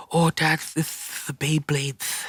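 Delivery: put-on high, slow little kid lisp; child with a lisp. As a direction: imitate a small boy's lisp. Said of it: "And he had a lisp".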